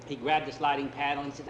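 ordinary delivery, nothing marked

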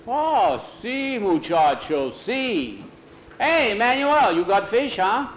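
a high, drawn-out call to Manuel; lightly voiced old Mexican man, calling across; old man hailing Manuel about the fish, high and slow; old Miguel calling out.

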